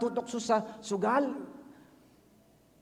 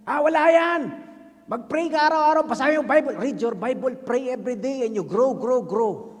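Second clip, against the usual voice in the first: high, loud brush-off, glib advice; breezy preacher waving the problem away; dismissive pastor; 5 semitones higher, 8 dB louder than usual. Retold or imitated. Pastor brushing off an addict's struggle with glib cheer. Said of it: "sabi sa akin".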